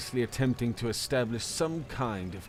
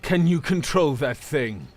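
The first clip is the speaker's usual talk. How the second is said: calling out; 3 semitones higher, 7 dB louder than usual. Acted. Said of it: "called out".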